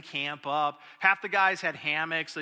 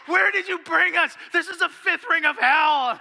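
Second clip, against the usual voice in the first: much higher, louder, frantic yelling; panicked shout; 11 semitones higher, 9 dB louder than usual. Retold or imitated. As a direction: scream it like they dragged you to hell. Freaking out after ticks found.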